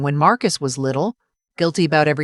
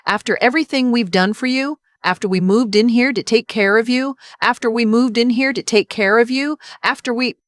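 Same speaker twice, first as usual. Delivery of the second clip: angry crying; 6 semitones higher than usual. Acted. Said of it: "the angry kind of crying".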